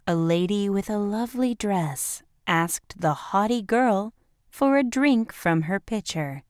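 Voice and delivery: plain reading voice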